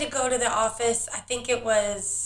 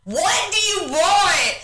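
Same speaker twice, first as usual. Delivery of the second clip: exasperated mock shout; 6 semitones higher than usual. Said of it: "I'm like".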